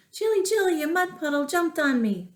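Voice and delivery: put-on high child's call to Jilly; pretend child calling. Teacher acting a child calling to Jilly. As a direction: cry it like a kid to mom. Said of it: "if you said".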